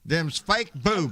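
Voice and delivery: put-on slow Southern accent, copying the line. Host tries the line back, in a slow Southern accent. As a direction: repeat it in a drawn-out Southern accent. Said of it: "in your best Southern accent".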